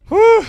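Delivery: loud, high exhale; sharp emotional whew. Real, not acted. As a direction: blow out the emotion in a high whoop. Steadying himself while fighting tears.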